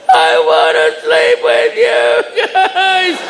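put-on very high, slow kid whine; very high slow child whimper. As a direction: beg like a scared toddler. Scared daughter voiced.

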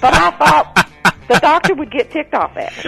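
amused at your own joke, laughing as you talk; punchline through laughter; real laughing. Real, not acted.

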